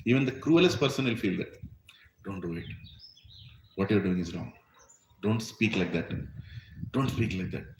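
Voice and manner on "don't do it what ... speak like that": low, soft inner admonition; quietly caution, like a lightly voiced conscience. Preacher voices God's inner warning to a wrongdoer, low and soft. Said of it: "Paramatma speaks".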